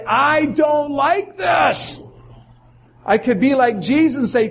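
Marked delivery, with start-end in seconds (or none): 0.0-2.0 crying out. Retold or imitated. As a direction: cry it out like Job, dragging every word. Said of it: "cry out and say".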